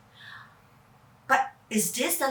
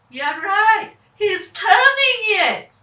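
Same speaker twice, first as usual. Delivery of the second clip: louder, higher, thrilled; excited; 7 semitones higher, 9 dB louder than usual. Acted. Narrator gasps as Kevin turns the key, excited. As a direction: cheer it on with a squeal.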